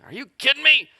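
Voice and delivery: high, loud comic outrage; hammed-up protest to the wife; mock indignant retort. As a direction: play the affronted husband, voice jumping up.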